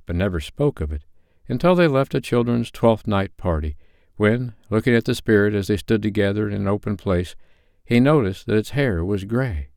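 measured storyteller reading aloud; story narration. A narrator's reading. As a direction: read it like a bedtime storyteller.

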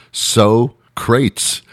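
Socrates drawn out high as "SO... CRATES"; Socrates mispronounced. Shown as a demonstration. Comedian mocking the host's misreading, Socrates mispronounced as "so-crates". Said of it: "he pronounced Socrates as".

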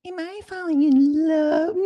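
a shrieking, very high cry of a question; hammed-up swooning squeal, then laughing; cartoonish falsetto wail of mock love. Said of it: "you're like".

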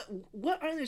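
high, stammering disbelief; mock alarm. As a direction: imitate a husband thrown by the answer. Acting alarmed husband questioning wife.

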